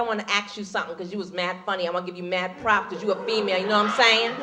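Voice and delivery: low, quick, soft street-talk impression; sister after the show. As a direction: rattle it off confidentially as a fan. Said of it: "a sister came up to me".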